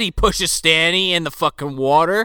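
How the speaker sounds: usual speaking voice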